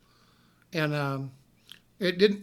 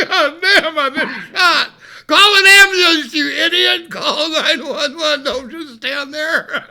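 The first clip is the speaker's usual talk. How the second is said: much higher and louder, laughing, last orders slow; frantic laughing yell; 7 semitones higher, 11 dB louder, slower than usual. Retold or imitated. Shot man yelling at the stunned clerk, loud and laughing. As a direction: shout for an ambulance half laughing. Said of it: "I'm going".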